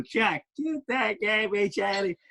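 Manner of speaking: a light take on Biz doing the catchphrase; imitating Biz. Biz teasing Jack with the catchphrase, a lightly voiced imitation. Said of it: "he'd go".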